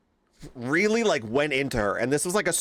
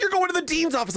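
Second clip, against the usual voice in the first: high, bossy teacher voice; pitched-up teacher mock order; faster than usual. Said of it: "And she was like".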